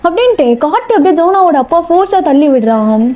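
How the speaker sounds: ordinary delivery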